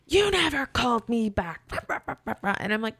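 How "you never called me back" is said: slow, high imitation of screaming caller; drawn-out hammed-up yelling; mock yell. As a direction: mimic an irate guest's accusation. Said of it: "proceeds to scream and yell at me".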